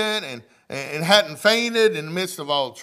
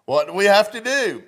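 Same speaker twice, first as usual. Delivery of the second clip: higher and louder, driving the question home; loud emphatic repeat; 6 semitones higher, 8 dB louder than usual. Real, not acted. Preacher pressing the congregation to answer, loud and high. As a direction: call it out to wake them up.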